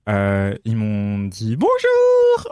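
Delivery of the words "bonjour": «Bonjour» sung very high and bright in mockery; mocking singsong. At his farewell party colleagues mock him, high singsong bonjour. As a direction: colleagues mocking her sung hello, lilting way up. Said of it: "en se foutant de ma gueule".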